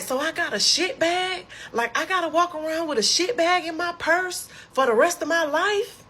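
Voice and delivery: very high-pitched outraged disbelief; reenacted freak-out, voice shooting way up; flipping out. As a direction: squeal it as an appalled question. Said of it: "I instantly go to flipping out".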